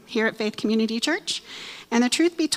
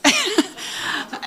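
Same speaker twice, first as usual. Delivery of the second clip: short high laugh; 11 semitones higher than usual. Real, not acted. Laughs at own demo.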